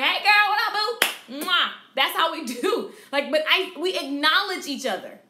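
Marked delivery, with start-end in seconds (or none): 0.0-1.7 put-on loud, high hey girl with a kiss sound; girlfriend greeting. Shown as a demonstration. Stylist greeting client like a girlfriend, loud and high. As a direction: greet her like your best friend. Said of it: "so I be like".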